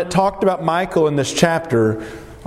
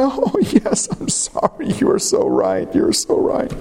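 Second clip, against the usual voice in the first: mock crying; 5 semitones higher than usual. What David didn't say to his scornful wife, mock-tearful grovelling. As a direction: fake sobbing apology, breaking into a whisper. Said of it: "Notice David didn't cry".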